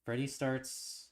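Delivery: steady, even storytelling read; story narration. A narrator's reading. Reading the game's story aloud to viewers, steady narration.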